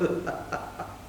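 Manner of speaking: brief amused laugh; short laugh. Real, not acted. Teacher amused after imitating grumblers, short laugh. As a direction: laugh at your own mimicry.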